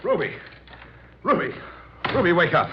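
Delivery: calling her awake in alarm; urgent calling. Acted. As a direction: rouse her fast.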